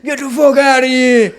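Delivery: put-on loud, slow New York accent shout. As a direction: yell it like an angry New Yorker. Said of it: "New York or Boston accent".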